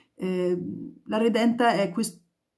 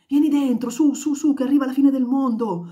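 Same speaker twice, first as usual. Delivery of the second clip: loud and high, urging someone in; frantic calling; 6 semitones higher, 5 dB louder than usual. Acted. Tenement neighbours calling someone in before the storm. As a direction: yell them indoors.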